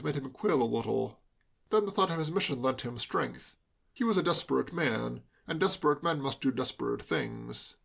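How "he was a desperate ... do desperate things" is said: ordinary delivery, nothing marked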